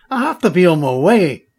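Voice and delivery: put-on Scottish accent. Duncan impatient to leave.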